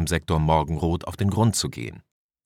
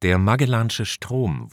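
ordinary delivery, nothing marked

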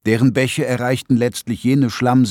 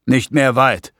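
repeated a bit louder, firmer; a little louder; 3 semitones higher, 4 dB louder than usual. A narrator's reading. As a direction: say it again, louder. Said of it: "wiederholte ein wenig lauter".

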